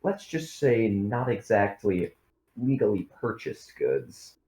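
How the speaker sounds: ordinary talk, nothing marked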